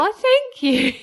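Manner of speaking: high, laughing, flattered thank-you; bashful real laughter on the thanks. Real, not acted. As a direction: laugh it out, touched by the compliment. Co-host compliments her voice.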